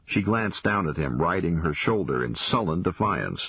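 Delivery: usual speaking voice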